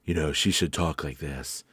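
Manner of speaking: low, soft deep female voice; smoky-room detective-story dame, pitched low; deep-voiced woman. Shown as a demonstration. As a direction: drop it deep and quiet for a flapper-era woman. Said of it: "should have a really deep voice".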